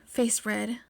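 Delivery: ordinary delivery, nothing marked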